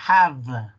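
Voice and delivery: "have" with a long buzzing v; careful "have", v held at the end; teeth-on-lip V. Shown as a demonstration. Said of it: "tu diente toque el labio de abajo".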